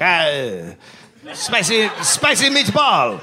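high, slow hammed-up Italian accent, shouted through laughs; a cartoon Italian exclaiming over a meatball. Poet imitated in a cartoon Italian accent, high and hammed-up. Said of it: "he did it with an Italian accent".

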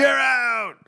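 high-pitched, drawn-out mock ejection call; hammed-up "out" call from the plate; umpire's call. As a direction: holler it like an umpire. Acting umpire ejecting Cobb.

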